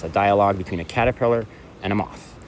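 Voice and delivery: normal talking voice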